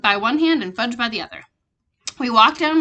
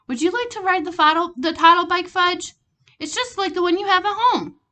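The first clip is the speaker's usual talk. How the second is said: put-on gentle to child; 8 semitones higher, 4 dB louder than usual. Father gently asking toddler.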